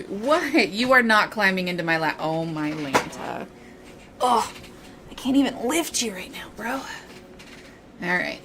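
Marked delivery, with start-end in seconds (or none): none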